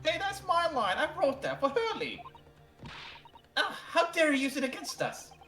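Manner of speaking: indignant outrage. Acted. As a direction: protest indignantly. Indignant character.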